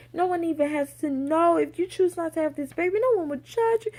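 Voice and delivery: high, sweet comforting voice; sweet, reassuring. Retold or imitated. Doctor comforting crying pregnant patient, high sweet reassurance. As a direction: coo soothingly, imitating a kind doctor. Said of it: "She was so sweet".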